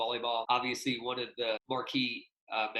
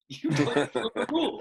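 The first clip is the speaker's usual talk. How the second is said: higher and louder, laughing through "You don't know the rules."; laughing retort; 9 semitones higher, 5 dB louder than usual. Retold or imitated.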